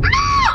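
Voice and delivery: high, loud frustrated "ah"; exasperated shout. Retold or imitated. Venting to friends about the bouncer with a frustrated shout. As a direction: let out a sharp exasperated cry.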